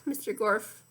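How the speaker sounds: ordinary delivery, nothing marked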